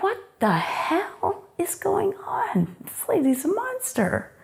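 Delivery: whispered high, slow disbelief; stunned whisper. Retold or imitated. Teen whispering to herself about the stepmother, shocked whisper. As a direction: hiss it under your breath in shock. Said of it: "I'm like".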